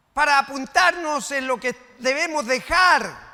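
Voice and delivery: loud, high fiery preaching; preaching at full force. Acted. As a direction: thunder the rebuke at the church. Preacher rebukes congregation loudly.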